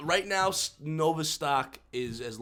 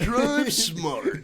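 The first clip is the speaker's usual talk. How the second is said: higher-pitched sponsor name drop; sports announcer; 6 semitones higher than usual. Acted. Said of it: "that's the guy from E S P N right there".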